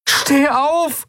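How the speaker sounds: very high, pleading cry; desperate shout. A narrator's reading. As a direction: beg him to get up. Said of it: "verzweifelt rufen".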